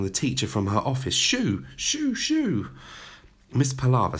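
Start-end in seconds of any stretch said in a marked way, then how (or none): 1.1-2.7 high, shrieked shoo. A narrator's reading. Headmistress chasing teacher out, high shooing. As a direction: flap her out with shrill shoos.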